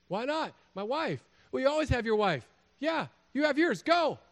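high-pitched comic bickering; escalating high-voiced mock exasperation; exasperated back-and-forth. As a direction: toss the replies back high, ending in a loud dismissal. Acted brush-off of a friend.

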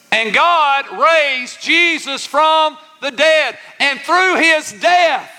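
high, slow, ringing proclamation; preaching climax. Real, not acted. Preacher proclaiming the resurrection to congregation, exultant and drawn out.

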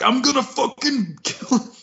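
high, hammed-up tough guy threat; mock macho threat; gym bro. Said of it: "I'm just like".

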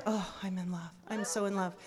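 soft, low, dreamy sigh; swooning. Retold or imitated. Smitten woman after his song, soft and dreamy. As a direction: melt as you say it. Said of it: "and I was like".

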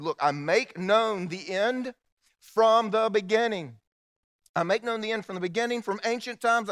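booming sermon build; preaching at full force. Acted. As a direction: proclaim it from the pulpit.

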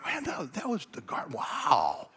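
hammed-up dawning realization; mock belated amazement. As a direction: play someone just getting it, stunned. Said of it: "you get home later and you go".

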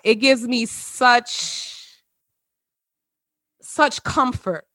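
slow, choked-up words; fighting off tears, slowly; holding back tears. Real, not acted. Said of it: "you know I'm gonna cry".

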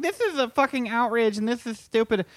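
put-on online indignation; mock outrage. Mimicking outraged Twitter user.

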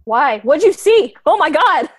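alarmed. Acted. Olive frightened by palm reading.

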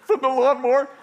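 put-on child confessing cheerfully; kid answering. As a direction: blurt it out innocently.

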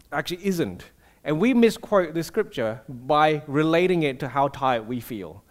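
ordinary delivery

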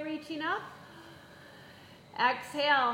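normal talking voice

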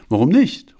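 high, loud eager question; called out. Acted. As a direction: call it out cheerfully. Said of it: "rief Johanna".

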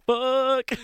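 high, loud burst of excitement; big retold outburst; excited yelp. Retold excited reaction to career news.

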